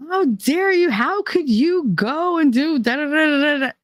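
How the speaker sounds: high, outraged telling-off; indignant scolding. Retold or imitated. Scolding her boyfriend about the beach trip, high indignant rant. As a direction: put him in his place.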